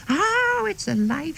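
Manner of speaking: high shrieking panic; mock shriek. Teacher spotting a humbug moving, high mock shriek. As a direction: squeal like a startled teacher. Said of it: "she's shouting".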